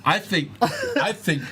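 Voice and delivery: high, slow, laughing through it; can barely get it out. Real, not acted. Laughing after the natural twenty.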